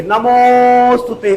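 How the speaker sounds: नमोस्तुते with the ओ held very long; नमोस्तुते chanted with a prolonged ओ; pluta vowel. Shown as a demonstration. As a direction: stretch the मो in नमोस्तुते slowly. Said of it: "ओ को आपने तीन बार पढ़ा".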